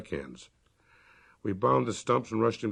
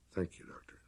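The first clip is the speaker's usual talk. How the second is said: whisper; 4 semitones lower, 12 dB quieter than usual. A narrator's reading. Emmeline thanks doctor, unvoiced.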